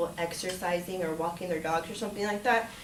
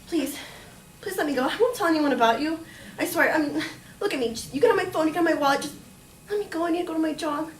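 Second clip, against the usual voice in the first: re-enacted pleading; 6 semitones higher than usual. Victim bargaining with gunman, re-enacted urgent pleading. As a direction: plead and bargain, pitch staying up.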